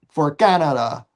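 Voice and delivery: ordinary delivery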